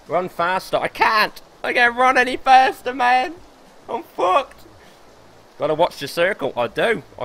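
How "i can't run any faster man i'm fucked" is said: high, louder panicked whine; desperate whine. Real, not acted. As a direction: whine that you can't go faster. Desperate running low health.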